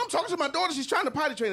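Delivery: ordinary delivery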